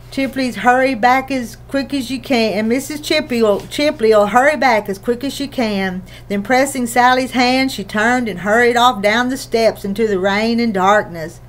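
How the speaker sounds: ordinary delivery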